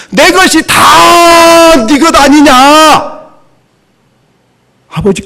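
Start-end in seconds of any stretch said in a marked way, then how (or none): 0.1-3.1 slow, high, drawn-out shout; father's pleading cry. Acted. Father calls out slowly to the angry elder son, high and loud. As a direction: call out each word slowly, like a father pleading with his son.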